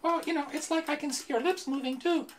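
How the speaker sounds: very high squeaky puppet voice. Acted. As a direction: pipe it up like a ventriloquist's dummy. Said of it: "you have this really high-pitched voice".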